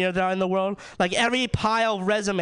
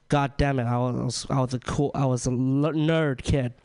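usual speaking voice

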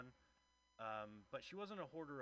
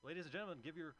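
raised host voice bringing on the comic; announcer intro; 6 semitones higher than usual. Real, not acted. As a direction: hype the next act to the room. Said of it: "host introducing next comic".

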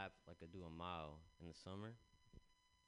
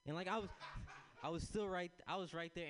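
raised, louder, keyed-up telling; rising excitement as it escalates; breathless storytelling peak; worked up; 8 semitones higher, 7 dB louder than usual. Real, not acted. Excited retelling chaos.

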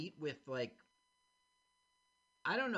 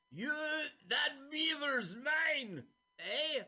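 villain voice; 12 semitones higher, 4 dB louder, much slower than usual. Retold or imitated. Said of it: "the bad guy is like".

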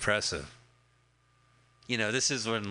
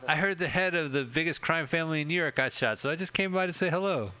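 higher-pitched, lightly acted visitor; Enzio; 6 semitones higher than usual. Visitor explaining why he came by, lightly acted. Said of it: "I'm Enzio, right".

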